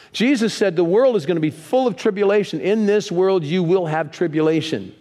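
normal talking voice

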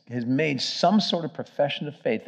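ordinary talk, nothing marked

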